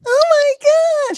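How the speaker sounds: high, slow squeal of joy; drawn-out girly squeal over a new phone; excited girlfriend. Retold or imitated. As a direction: gush like a thrilled teen girl.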